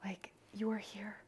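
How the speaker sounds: hushed, near-whispered wonder; tearful awe. Retold or imitated. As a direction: breathe it softly, moved by the newborn. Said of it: "swelling with tears and just like".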